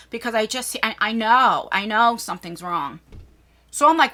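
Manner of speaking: normal talking voice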